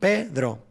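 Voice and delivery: "Pedro" with PE punched and dro falling away; stressed syllable. Shown as a demonstration. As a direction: hit the first syllable of "Pedro". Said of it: "P es la sílaba tónica de Pedro".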